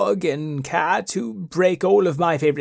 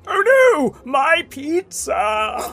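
high, louder oh no, slow my pizza; dismayed cry; 8 semitones higher, 3 dB louder, much slower than usual. Acted. Wizard crying out as his pizza falls, high dismay. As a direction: wail at the falling pizza.

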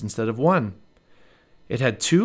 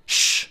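sharp, drawn shushing sound; hushing; 4 dB louder than usual. A narrator's reading. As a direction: hush someone who is too loud. Jack hushes the calling girl.

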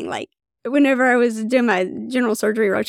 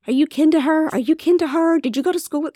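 lightly voiced nurses, pitched up and teasing; 6 semitones higher than usual. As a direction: tease her like the curious hometown nurses. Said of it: "the nurses are like".

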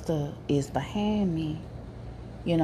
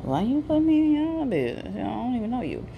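high, indignant why-me aside; huffy, offended question to herself; high-pitched huffy why-me; 7 semitones higher than usual. Retold or imitated.

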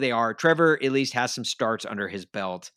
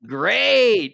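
higher, louder, drawn-out mock cheer; sarcastic delight; 11 semitones higher, 9 dB louder than usual. Real, not acted. Fan reacting to a photo of the quarterback getting sacked, sarcastic delight. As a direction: belt out an ironic cheer.

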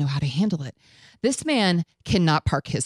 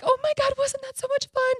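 high, bubbly squeal of joy; thrilled chatter to date after roller coaster; giddy excitement. Retold or imitated. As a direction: gush about the ride, much higher. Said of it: "giggling and laughing and I'm like".

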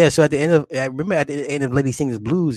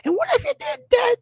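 very high falsetto mock woman; mocking movie woman. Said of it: "she's like".

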